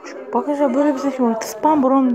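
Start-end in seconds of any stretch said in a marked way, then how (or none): 1.4-2.2 imitated bird call. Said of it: "ama kızık sesli".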